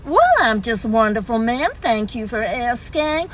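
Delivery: mock American customer-service politeness; put-on American accent. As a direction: imitate a gushing American server, swooping tune. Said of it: "Sorry, forgive the accent".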